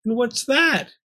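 high-pitched distressed question; sobbed. A narrator's reading. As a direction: ask it tearfully, pitched high. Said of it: "Pearl sobbed".

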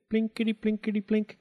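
high plinking mimicry; mandolin imitation. A narrator's reading. The girl plays her mandolin, imitated in high plinks. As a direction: plink it out in a high pitch like plucked strings. Narrator voices the mandolin's plucking.

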